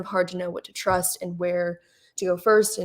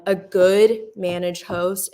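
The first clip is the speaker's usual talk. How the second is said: "good" drawn out and louder; stress on good; 5 dB louder, slower than usual. Real, not acted. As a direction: lean hard and slow on the word "good". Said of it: "emphasis on the word good".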